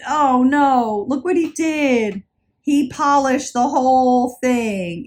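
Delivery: hammed-up slow horror; dismayed oh no. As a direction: react like your treasure was just ruined. Retelling her ruined tarnished bracelet.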